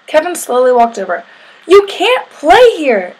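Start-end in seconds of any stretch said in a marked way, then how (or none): 1.7-3.1 high, loud, drawn-out storybook yell. A narrator's reading. Sammy spots Kevin nearing the sandbox, yelling. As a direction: yell it slowly as a playground bully. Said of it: "Sammy yelled when he saw him".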